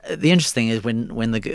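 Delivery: ordinary talk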